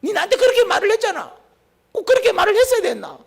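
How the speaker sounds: an offended person demanding why they were spoken to like that; hurt reproach. Acted.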